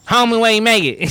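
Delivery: mock Bobby impression; Waterboy Bobby voice. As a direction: squeak it like the Waterboy hillbilly. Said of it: "You know, Bobby".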